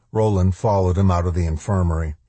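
ordinary delivery, nothing marked

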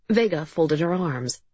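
plain reading voice

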